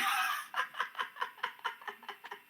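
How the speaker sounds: high breathy burst of laughter. Real, not acted. Player cracking up at the reveal.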